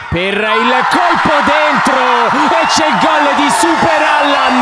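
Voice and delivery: loud high goal shout; excited goal call. Real, not acted. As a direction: belt out the scorer's name.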